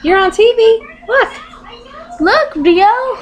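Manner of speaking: high-pitched cooing to a pet bird; high, eager pet talk; coaxing a pet. Real, not acted. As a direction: get the bird's attention in a lifted voice. Coaxing pet bird toward camera.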